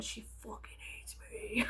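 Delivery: breathy whispered aside. Real, not acted. Telling the listener about her mentor, whispered aside. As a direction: whisper it like a secret. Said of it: "whispered aside".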